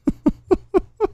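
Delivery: very high-pitched laughter. Real, not acted. Streamer cracking up at a garbled post, squealing laugh.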